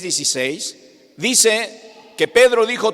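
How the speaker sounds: ordinary delivery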